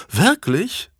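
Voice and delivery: amused. A narrator's reading. King delighted to hear they have met, asking high and amused. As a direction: let the king's delight lift it. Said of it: "fragte Edward vergnügt".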